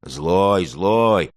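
taunting little heckler; interrupting small voice. A narrator's reading. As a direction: cut in with an emphatic jeer. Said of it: "прервал её чей-то голосок".

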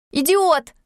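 резкий выкрик, выше и громче; швырни ему это в лицо; крик. Acted. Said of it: "крикнула я". (возмущённый крик, высоко и громко)

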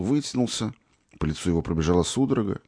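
plain reading voice

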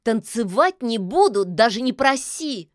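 a touch of huffy indignation; indignant. A narrator's reading. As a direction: refuse with mild outrage. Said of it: "возмутилась Яга".

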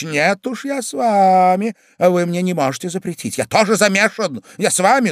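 usual speaking voice

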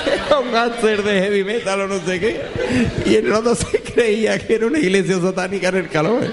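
high voice shaking with laughter; laughing. Real, not acted. Telling the friend the twist, laughing hard. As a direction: laugh through the punchline.

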